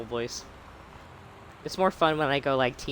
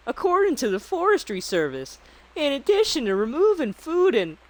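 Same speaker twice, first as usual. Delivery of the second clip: put-on Canadian accent. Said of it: "I can't do the Canadian accent".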